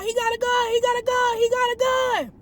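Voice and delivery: high frantic repeat; imitating the radio panic; mock panic. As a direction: replay the alarmed caller, voice way up. Retelling the panicked caller.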